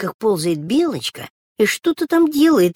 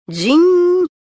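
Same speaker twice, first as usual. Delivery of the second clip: doorbell ring; 13 semitones higher, 7 dB louder than usual. A narrator's reading. Doorbell ringing again.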